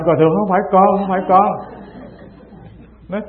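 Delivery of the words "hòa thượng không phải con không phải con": higher, louder acted plea of innocence; mock-frightened, insistent denial; panicked protest. Terrified monk protests to the master, acted panicked denial. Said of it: "hồn vía lên bay và bạch".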